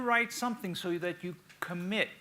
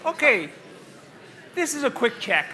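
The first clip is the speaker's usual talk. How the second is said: calling the class; 4 semitones higher, 4 dB louder than usual. Real, not acted. Teacher calls class back to attention.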